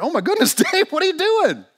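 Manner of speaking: high, loud, exasperated outburst; upset yelling. Acted. Bob berates buddy who jumped in too, upset yelling. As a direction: scold a friend at a shout in disbelief. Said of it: "But Bob's pretty upset".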